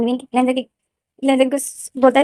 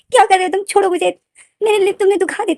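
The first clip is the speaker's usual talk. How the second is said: high protesting plea; 8 semitones higher than usual. Acted. To Wing, pushing him off: high protesting plea. Lenden pushes Wing away.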